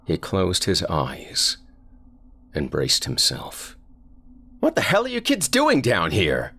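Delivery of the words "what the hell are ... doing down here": put-on a father scolding kids from the stairs; angry father yelling. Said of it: "his father yelled".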